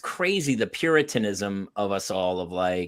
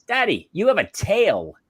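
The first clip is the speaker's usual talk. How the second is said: lightly voiced small child, higher pitch; a hint of a little girl, pitched up; imitated little daughter; 7 semitones higher than usual. Small daughter to her dad in the tub, lightly imitated child voice. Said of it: "one of my daughters goes".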